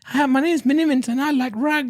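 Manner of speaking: very high mock-posh character voice; put-on posh voice. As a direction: squeak it out like a caricature of a private-school type. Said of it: "He put on the voice".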